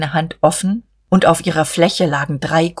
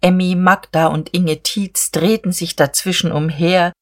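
ordinary delivery, nothing marked

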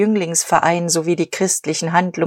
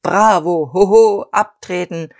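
voiced crowd heckling; crowd outburst; 4 dB louder than usual. A narrator's reading. Suppliers shouting down Heuteufel, loud. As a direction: call it out like an outraged crowd. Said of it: "explodierten die Gefühle der Lieferanten".

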